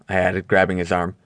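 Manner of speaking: usual speaking voice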